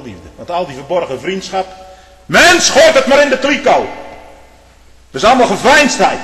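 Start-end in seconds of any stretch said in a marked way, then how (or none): 2.3-6.2 loud, high fire-and-brimstone preaching; preaching at full force. Acted. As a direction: thunder it from the pulpit.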